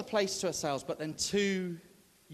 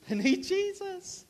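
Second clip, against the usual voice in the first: fervent emphasis; 4 dB louder than usual. Real, not acted. Preacher stressing key answer.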